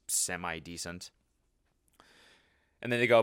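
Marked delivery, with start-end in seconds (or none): 0.1-1.1 low, soft, slow shrug of a verdict; lukewarm dismissal. Retold or imitated. Imitating a commenter's faint praise, underwhelmed. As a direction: let it drop quietly, unimpressed.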